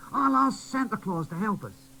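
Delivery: put-on high, bright child voice; boy doll urging the toys to play, high; cheerful little boy doll. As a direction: cheer them up. Quincy's plan.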